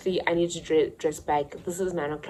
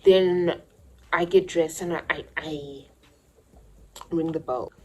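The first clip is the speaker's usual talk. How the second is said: normal talking voice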